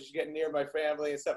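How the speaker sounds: ordinary talk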